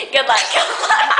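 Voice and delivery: giggly repeated well-wish; laughing mock quote. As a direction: say it through laughter. Acting out a joke, laughing.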